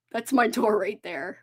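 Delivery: normal talking voice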